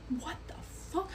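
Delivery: breathy, hushed disbelief, a little slow; stunned hushed curse while hiding; shocked whisper. Retold or imitated. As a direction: whisper it so they can't hear you. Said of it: "And I'm like".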